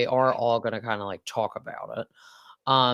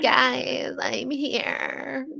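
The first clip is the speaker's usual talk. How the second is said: imitating Raquel; 12 semitones higher, much slower than usual. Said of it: "She'll be like".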